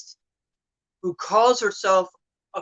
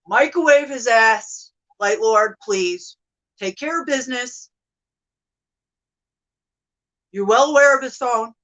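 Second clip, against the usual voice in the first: loud, higher, demanding cry; calling out; 4 semitones higher, 7 dB louder than usual. Real, not acted. Pleading loudly to the Light Lord for revenge, loud call. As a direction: summon him with a loud command.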